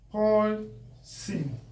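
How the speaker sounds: "Poison" as poi, long pause, sin; split by syllable. Shown as a demonstration. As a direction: break "Poison" into two separate beats. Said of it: "I'm breaking it according to the syllable".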